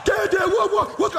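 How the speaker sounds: high, loud mock-alarmed yelps; hammed-up, high-pitched repeated protests; protesting kids. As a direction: imitate boys clamouring at their dad in disbelief. Acts out sons protesting to dad.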